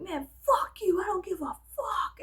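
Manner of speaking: high-pitched brash friend imitation; hammed-up rebellious attitude; mock defiance. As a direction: toss it off as the defiant bad girl. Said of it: "she was so funny".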